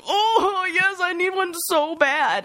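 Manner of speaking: high, slow, near-crying voice; relieved sob to the partner who offered a hug; tearful relief. Retold or imitated. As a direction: choke up with relief as you say it.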